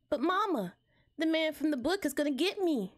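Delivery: put-on high-pitched child calling for mama; little kid. Voices scared Junior Rabbit.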